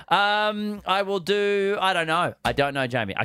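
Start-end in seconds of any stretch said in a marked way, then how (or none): none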